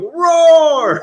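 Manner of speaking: put-on high, loud squealed baby roar; one-year-old roaring. Dad picturing his daughter's voice on the NFT, high baby shout. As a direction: shout it like a tiny toddler. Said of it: "a one-year-old going".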